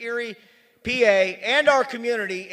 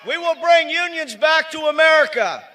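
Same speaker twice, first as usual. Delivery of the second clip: louder and higher with each line, full-throated; rally shout; 5 semitones higher, 5 dB louder than usual. Real, not acted. Union leader rousing the rally crowd, rising to a shout. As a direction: rouse the crowd to a roaring close.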